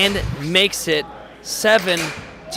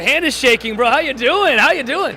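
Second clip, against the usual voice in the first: much higher, louder cheery hello; hyped greeting; 7 semitones higher, 4 dB louder than usual. Real, not acted. Commentator greeting the winner for an interview, hyped. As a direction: pump up the player.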